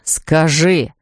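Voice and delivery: low, clipped repeated order; low angry insistence. Acted. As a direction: repeat it, darker and harder. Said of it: "твердил он багровея".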